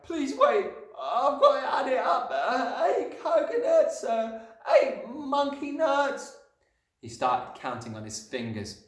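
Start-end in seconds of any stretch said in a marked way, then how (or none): 0.0-6.4 high, halting schoolboy panic; flustered Wilfred. A narrator's reading. Wilfred counting on his fingers, high, hesitant and flustered. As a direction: stall for time in a raised, anxious voice. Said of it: "Wilfred was properly flustered".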